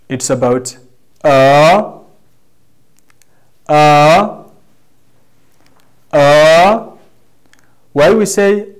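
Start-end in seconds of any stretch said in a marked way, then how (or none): 1.2-6.9 "Uh" sliding from down to up; rising tone. Shown as a demonstration. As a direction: start "Uh" level, then lift it loudly to the end. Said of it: "instructs us to rise up from down to up".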